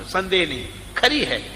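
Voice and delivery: ordinary talk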